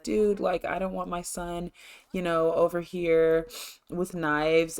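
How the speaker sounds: normal talking voice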